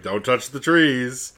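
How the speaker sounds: museum guard. Acted. Guard patrolling round the trees, stern mock warning. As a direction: imitate a guard warning people off. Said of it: "like, a museum guard".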